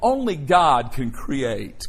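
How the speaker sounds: slow and deliberate, each word weighted; preacher slowing down to drive the point home; slow emphasis. Shown as a demonstration. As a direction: let it land: only God can create. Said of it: "Notice the word create".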